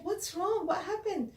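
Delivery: put-on high concerned girl voice; lightly voiced worried girl asking. A narrator's reading. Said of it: "She said".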